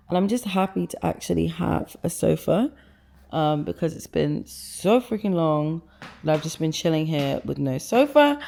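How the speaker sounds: usual speaking voice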